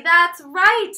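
ordinary delivery

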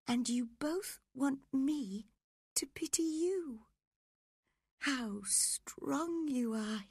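high-pitched reproachful woman; dying Catherine. Acted. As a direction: reproach him in a high, weak voice.

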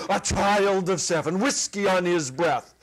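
loud, high, furious scolding; angry relatives, shouting high; wrathful scolding. Acted. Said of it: "into a wrathful tune".

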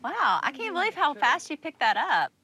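usual speaking voice

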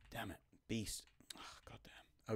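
hushed frustrated whisper; whispered curse. Real, not acted. Teammate in danger, hushed whisper. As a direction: breathe the curse under your breath.

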